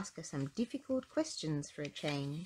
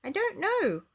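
hurried, high, louder blurt; quick reply; 12 semitones higher, 5 dB louder than usual. A narrator's reading. Little Miss Brainy answers the hungry lion, quick and high. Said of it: "and said quickly".

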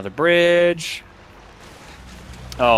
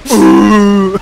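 Leon's wounded moan as a seal; dying seal groan; 7 semitones higher, 9 dB louder than usual. Retold or imitated. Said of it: "Leon always sounded like a, a dying seal".

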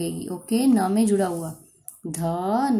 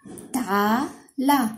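ताला drawn out very slowly, mouth wide open; sounding out slowly; 4 semitones higher, much slower than usual. Shown as a demonstration. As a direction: stretch each syllable of ताला. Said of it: "मुंह को ऐसे खोल के बोलेंगे".

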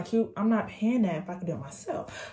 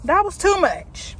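exasperated; 9 semitones higher, 4 dB louder, slower than usual. Real, not acted. Exasperated after balloon struggle.

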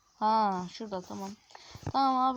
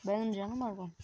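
ordinary talk

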